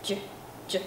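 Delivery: breathy ch with air bursting out; voiceless ch. Shown as a demonstration. Teacher shows the voiceless ch sound. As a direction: puff ch out with no voice. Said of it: "Các bạn thấy nè".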